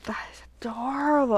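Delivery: soft adoring whisper. Real, not acted. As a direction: breathe the word adorable. Gushing over craft dies.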